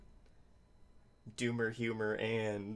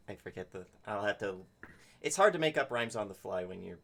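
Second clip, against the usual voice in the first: ordinary delivery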